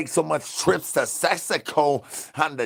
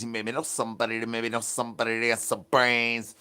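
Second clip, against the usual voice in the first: low mumbled reply voice; 4 semitones lower, 7 dB quieter, faster than usual. Acted.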